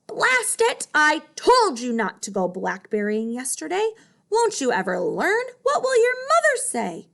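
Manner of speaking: furious, scold yourself at the top of your voice; angry yelling lion. Acted. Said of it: "an angry animal roaring".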